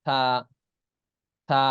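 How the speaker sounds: থা as a lisped th, tongue tip slightly out; tongue lightly out. Shown as a demonstration. Teacher drilling an Arabic letter, থা with tongue lightly out. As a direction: let the tongue peek out for থা. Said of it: "জিহ্বা হালকা বের হবে".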